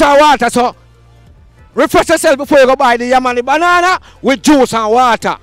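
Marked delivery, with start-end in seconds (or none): none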